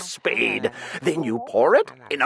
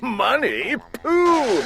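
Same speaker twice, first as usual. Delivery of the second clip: laughed off; 5 semitones higher, slower than usual. A narrator's reading. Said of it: "the glunk just laughed".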